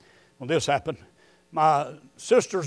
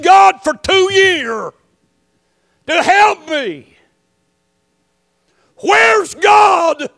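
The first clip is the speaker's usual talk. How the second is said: anguished plea; 11 semitones higher, 9 dB louder, slower than usual. Retold or imitated. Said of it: "he said this to me over the phone crying".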